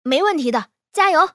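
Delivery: loud cheering on, rising high; encouraging. A narrator's reading. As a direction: cheer the struggling pupil on. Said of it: "魏无羡鼓励他".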